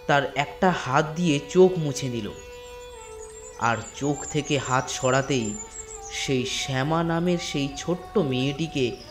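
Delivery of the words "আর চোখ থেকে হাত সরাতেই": ordinary delivery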